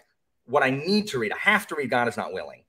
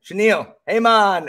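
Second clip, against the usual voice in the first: loud, high teasing call in mock Jamaican; mock Jamaican greeting; 8 semitones higher, 8 dB louder than usual. Teacher bugging his Jamaican student, in mock Jamaican accent. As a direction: call out with a put-on Jamaican lilt. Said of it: "So I would always bug her".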